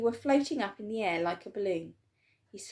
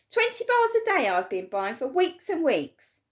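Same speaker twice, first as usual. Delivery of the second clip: high, loud grumbling shout; boy furious over his wasted money; angry complaint; 8 semitones higher, 8 dB louder than usual. A narrator's reading. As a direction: complain bitterly.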